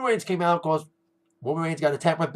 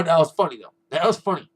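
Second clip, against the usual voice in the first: ordinary talk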